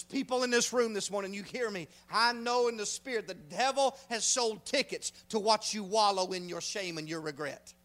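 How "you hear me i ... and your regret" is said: ordinary delivery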